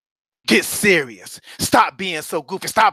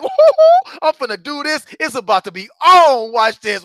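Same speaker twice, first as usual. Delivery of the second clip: fired-up; 7 semitones higher, 6 dB louder than usual. Acted. Fired-up kicker, acted.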